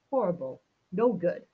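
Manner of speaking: usual speaking voice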